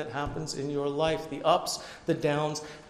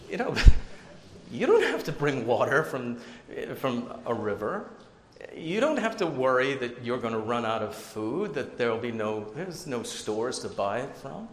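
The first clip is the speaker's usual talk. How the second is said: usual speaking voice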